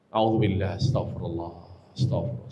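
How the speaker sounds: low murmur sinking into a whisper; calm yourself down, whispering the words; fading whisper prayer. Shown as a demonstration. Wife calming her anger, whispered prayer. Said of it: "berupaya meredam".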